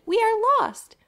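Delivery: high, small frightened voice; chicks crying. A narrator's reading. Lost ostrich chicks calling out in fright. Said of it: "they cried".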